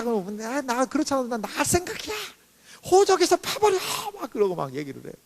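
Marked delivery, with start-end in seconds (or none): 0.4-3.8 mock-defiant, rising high at the end; defiant teen outburst. Daughter telling mom she is leaving for good, petulant and defiant. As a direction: play a daughter threatening to walk out. Acted mother-daughter quarrel, daughter storms out.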